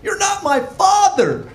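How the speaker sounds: put-on high, slow sulky kid voice; high, drawn-out bratty "You're not my father"; bratty teen. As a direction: whine it like a defiant teenager. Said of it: "He's like".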